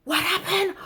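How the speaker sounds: imitating boyfriend's high alarmed cry; panicked boyfriend. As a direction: voice the boyfriend seeing the blood, alarmed. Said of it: "he was like".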